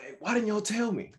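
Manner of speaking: high, sharp scolding question; mild retold scold at the kids; kinda mad. Dad scolding his kids over the mess, pitched high. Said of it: "getting kinda mad at the kids".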